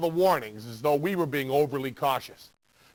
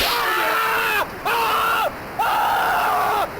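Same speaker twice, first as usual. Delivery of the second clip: long, high, loud fake scream of pain; 5 dB louder, much slower than usual. Faking a shock from the game to horrify the sucker, screaming loud and high. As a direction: howl as if the controller shocked you.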